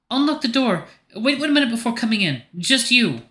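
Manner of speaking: high-pitched character voice, giving instructions; Grace behind the door. Acted.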